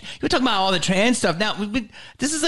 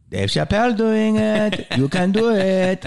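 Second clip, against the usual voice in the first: mock club owner's broken English, pitched up; Jamie Masada; 3 semitones higher than usual. Club owner urging a comic, imitated pitched-up coaxing. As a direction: coax in an imitated promoter's voice. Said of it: "Jamie's like".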